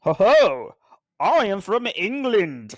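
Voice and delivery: loud, high, hammed-up English accent; theatrical fake English accent, drawn out; attempted English accent. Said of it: "You're trying to do an accent".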